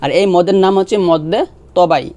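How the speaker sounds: ordinary talk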